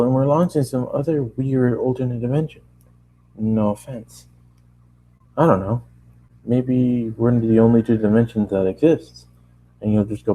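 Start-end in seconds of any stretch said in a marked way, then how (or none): none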